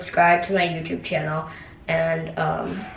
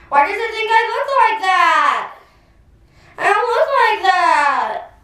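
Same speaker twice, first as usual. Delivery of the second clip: filter voice; 11 semitones higher, 4 dB louder than usual. Real, not acted. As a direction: whine it in a squeaky filter voice. Filter voice pitched up.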